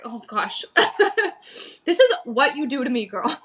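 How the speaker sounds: real laughter breaking up the words; embarrassed laughing aside to a friend. Real, not acted. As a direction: giggle your way through the apology. Laughing after apologising.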